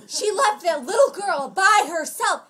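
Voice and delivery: high, loud, slow mock yell; retold yelling. Imitating the lady yelling at Nicole, high emphatic scolding. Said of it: "And she's yelling at Nicole".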